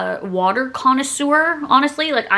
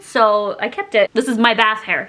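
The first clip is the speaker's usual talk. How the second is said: usual speaking voice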